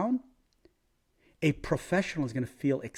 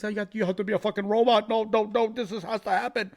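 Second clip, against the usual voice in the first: higher, faster sneering voice of a know-it-all critic; mock the people who call him a robot, higher and louder; 8 semitones higher, 6 dB louder than usual. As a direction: mimic the whiny critics, fast and high. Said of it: "Everybody thinks".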